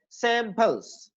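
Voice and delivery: Samples with a slow, drawn-out "SAAM"; long first syllable. Shown as a demonstration. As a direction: lengthen the first vowel of samples, let the end fall. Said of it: "इसको long करना है".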